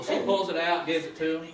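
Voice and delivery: ordinary delivery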